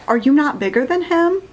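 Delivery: normal talking voice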